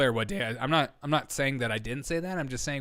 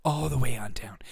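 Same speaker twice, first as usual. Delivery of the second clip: hushed, whispered echo of the phrase; mock serious whisper. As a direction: whisper it like a grave secret. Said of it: "Did you hear my voice inflection?".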